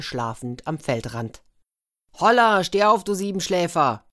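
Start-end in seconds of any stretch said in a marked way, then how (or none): 2.2-4.0 loud, high wake-up yell; shouting. A narrator's reading. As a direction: shout at a sleeper to get up. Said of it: "schrien die Boten Tihota an".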